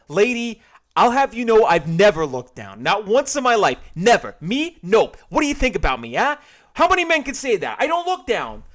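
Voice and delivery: very high, loud puffed-up boasting; indignant boast. Acted. George defending himself to the cashier, loud bluster. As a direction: bluster like a proud little man.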